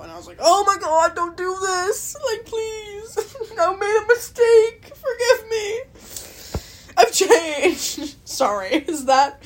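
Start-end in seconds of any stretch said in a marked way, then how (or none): 0.4-8.2 high-pitched, hammed-up crying plea; mock tearful pleading. Vlogger begging forgiveness over Ohio mistake, mock wailing.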